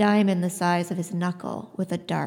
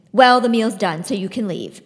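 defiant; 7 dB louder than usual. Acted. Citra grabs the plate, dismisses him.

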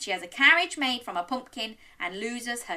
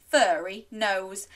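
ordinary delivery, nothing marked

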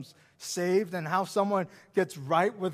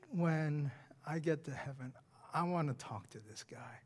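whisper; 6 semitones lower, 11 dB quieter than usual. Real, not acted. Whispered personal aside.

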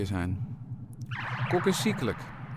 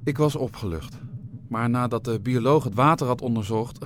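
ordinary delivery

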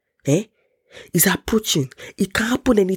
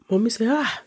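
ordinary delivery

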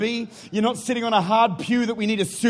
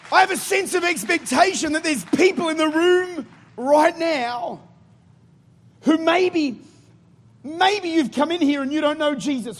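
higher, driving preacher's declaration; stirring pulpit call to faith; fervent preaching; 6 semitones higher than usual. Acted. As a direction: proclaim it over the room with conviction.

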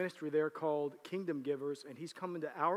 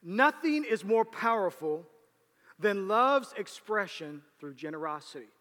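preached; 4 semitones higher, 8 dB louder than usual. Acted. Preaching climax.

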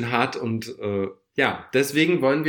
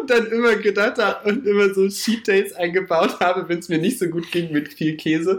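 laughing; 6 semitones higher than usual. Real, not acted. Talks on through laughter.

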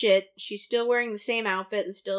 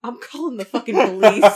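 laughing mock shout; 12 dB louder than usual. Real, not acted. Laughing mock threat to cohost.

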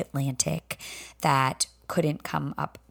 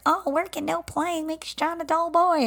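Shining quote; 12 semitones higher than usual. Retold or imitated. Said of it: "literally like The Shining".

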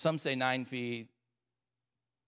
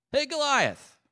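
high-pitched challenging call; trash talking; 13 semitones higher, 3 dB louder, slower than usual. Acted. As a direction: call out the giant across a field. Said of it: "starts trash talking this giant".

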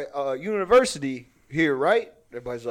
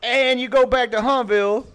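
Cajun guy voice; 5 semitones higher than usual. Pretend. Host performing a bit for returning audience, mock Cajun guy voice. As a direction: tell it as a comic Cajun, pitched up. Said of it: "like, Cajun guy voice".

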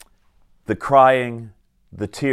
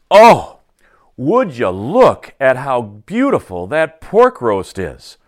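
loud "Oh", then slow, drawn-out admiration; admiring exclamation; 6 dB louder, slower than usual. Retold or imitated. Husband exclaiming over roast at the oven, admiring exclamation. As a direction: marvel loudly at the roast, lingering on each word. Acting out his kitchen exclamation.

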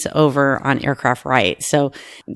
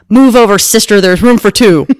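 loud, higher, playful quote; brash joking call; 5 semitones higher, 7 dB louder than usual. Retold or imitated. Crew friend joking about the couple, brash and loud. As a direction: belt it out as a punchline.

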